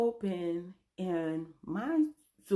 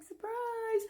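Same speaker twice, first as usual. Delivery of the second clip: much higher, sing-song party yell; mock surprise shout. Guests jumping out at her birthday, high mock cheer.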